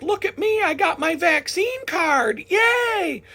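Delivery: very high, squeaky mock bragging; mocking coworker. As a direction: show off a vaccine card like a smug child.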